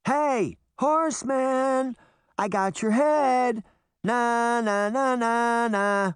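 high, slow sing-song tease; taunting. A narrator's reading. Reluctant bait calling to the horseman, sing-song taunt. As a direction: chant it like a drawn-out playground jeer. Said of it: "taunt the horsemen".

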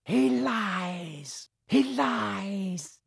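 high, thin accusing villain voice. A narrator's reading. Hidden voice accuses Harry to Quirrell, high and eerie. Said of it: "a high voice spoke".

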